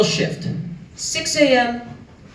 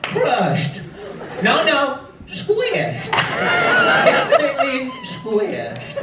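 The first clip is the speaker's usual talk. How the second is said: mock clueless taster; 5 semitones higher, much slower than usual. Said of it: "I'd be there like".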